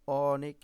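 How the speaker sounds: "অনেক" with a long, loud opening ɔ; open ɔ sound. Shown as a demonstration. Teacher showing pupils the vowel অ, drawn out and open. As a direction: stretch the first vowel of "অনেক" as ɔɔ-nek. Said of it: "এটা হচ্ছে বিবৃত উচ্চারণ".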